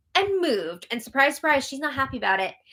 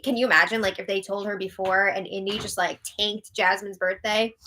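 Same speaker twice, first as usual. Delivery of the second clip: ordinary talk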